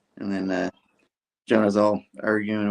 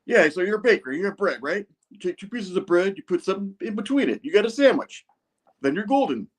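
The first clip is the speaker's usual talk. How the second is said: much higher, lecturing put-on voice; bossy Jonah; 11 semitones higher than usual. Jonah lectures the baker about sandwiches in a high, bossy put-on voice. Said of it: "He's like".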